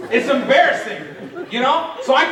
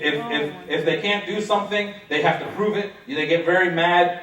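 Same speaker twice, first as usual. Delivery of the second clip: ordinary talk, nothing marked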